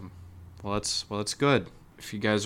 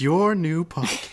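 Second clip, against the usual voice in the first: mocking repeat; 9 semitones higher, 6 dB louder, slower than usual. Mocking the podcast plug.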